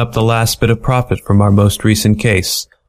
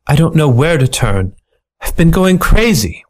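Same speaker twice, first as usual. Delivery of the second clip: higher, melodramatic plea; distressed widow; 6 semitones higher than usual. Acted. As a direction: lay on the despair of a femme fatale. Mink pleads to detective.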